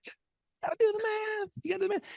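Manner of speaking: high, soft mocking echo of the line. Host mocking his own catchphrase in a high voice. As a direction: squeak it back like a baffled listener.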